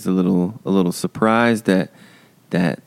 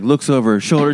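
usual speaking voice

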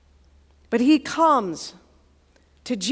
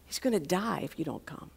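hushed, low plea of a desperate dad; quiet desperation; 6 semitones lower, 13 dB quieter than usual. Acted. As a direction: beg softly, voice dropped right down. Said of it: "hear the desperation in this dad's voice".

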